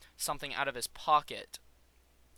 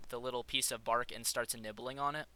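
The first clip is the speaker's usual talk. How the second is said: normal talking voice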